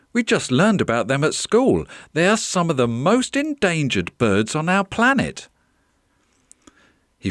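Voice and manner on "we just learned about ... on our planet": put-on higher-pitched storybook boy; lightly voiced excited boy; eager schoolboy. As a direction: share a school fact with animated interest.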